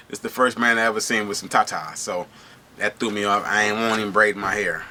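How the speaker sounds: ordinary delivery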